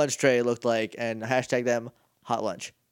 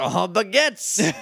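slow, hammed-up fake French accent; 3 semitones higher, much slower than usual. Mocking the French detective in a slow, hammed-up French accent. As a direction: drag it out in a cartoon French accent. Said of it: "It's also an offensive French accent".

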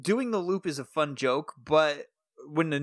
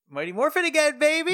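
high, loud hype; excited cheer; 12 semitones higher, 4 dB louder than usual. Real, not acted. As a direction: cheer it like a fan. Hyped cheer about rewatching.

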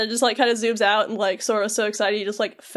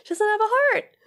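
high-pitched little cry; play-tragic high voice; mock wail; 11 semitones higher, faster than usual. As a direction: squeak it out in mock distress. Squeals answer to co-host's mock lament.

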